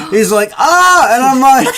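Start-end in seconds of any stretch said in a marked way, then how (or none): 0.6-1.1 high, loud mock wail; hammed-up yelp of pain; imitated crying scream. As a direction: cry out like a hurt kid. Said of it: "he's crying".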